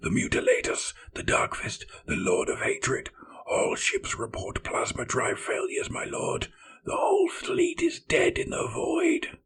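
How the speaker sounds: frantic whispering, near tears; panicked. Acted. Terrified serf listing dead ships to his lord, whispering. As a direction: rattle off the failures in mounting terror. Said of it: "a panic serf shouted".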